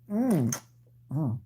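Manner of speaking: ordinary delivery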